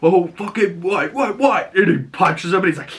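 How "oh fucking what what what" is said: high-pitched, riled-up challenge; mock fight taunt. Acting out Frank mid-fight, riled mock taunt. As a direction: goad him, hammed-up.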